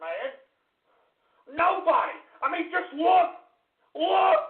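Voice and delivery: ordinary talk, nothing marked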